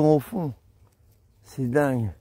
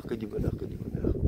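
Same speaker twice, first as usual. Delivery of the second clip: softer, whispered repeat, fading out; whispered contentment; 2 semitones lower, 8 dB quieter than usual. Real, not acted. Walker savouring the morning to himself, whispered and fading. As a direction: murmur it to yourself, blissfully content.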